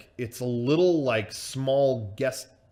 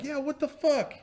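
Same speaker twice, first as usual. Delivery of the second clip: high-pitched mock outrage; indignant outburst. Drunk friends finding their ride gone, indignant outburst. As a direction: imitate a drunk's indignant squawk. Mimics outraged group leaving.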